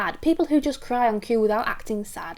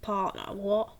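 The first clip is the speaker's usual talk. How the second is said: stunned realisation; 3 dB quieter than usual. Shown as a demonstration. Character realising who sleeps with their partner. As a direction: think it with shocked disbelief. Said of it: "that thought in the eyes".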